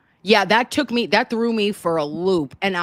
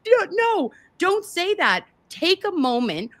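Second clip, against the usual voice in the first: much higher, urgent mock plea; alarmed plea; 11 semitones higher than usual. Co-host imagines urging the judge to stop, high alarmed plea. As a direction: beg her to stop, voice leaping up.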